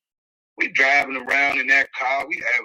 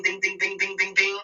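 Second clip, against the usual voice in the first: sung-out ding ding ding, higher; mimicked bell dings; 6 semitones higher than usual. Host urging viewers to subscribe, mimicked bell dings. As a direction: chime like a notification bell.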